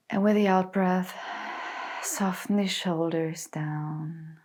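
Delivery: calm, unhurried meditation voice with long pauses; guided meditation. Acted. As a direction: guide them gently into stillness, letting phrases settle. Leads a guided body scan meditation.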